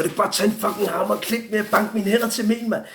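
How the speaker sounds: high-pitched, heated retold protest; acting out an exasperated outburst; retold angry outburst. Retold angry outburst at his captors.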